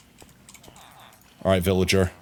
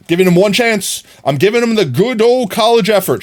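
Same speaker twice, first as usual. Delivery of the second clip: excited; 9 semitones higher, 4 dB louder than usual. Real, not acted. Fishing villager out.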